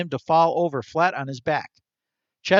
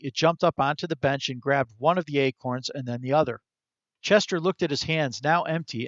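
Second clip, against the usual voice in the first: usual speaking voice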